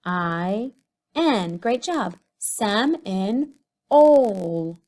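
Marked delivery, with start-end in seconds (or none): 2.4-4.8 Sem, in, ol said apart with ol stretched and loud; sounding out for children; syllables split. Shown as a demonstration. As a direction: stretch the long O in ol. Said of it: "Do you hear the long O?".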